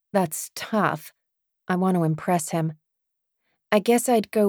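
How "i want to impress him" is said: ordinary delivery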